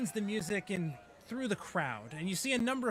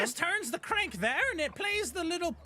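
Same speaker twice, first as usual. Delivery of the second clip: high-pitched goblin voice; little goblin explaining eagerly, pitched high; 11 semitones higher, faster than usual. Acted. Goblin showing how Ernest's crank works, high voice. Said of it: "goblin dressed as a ladybug".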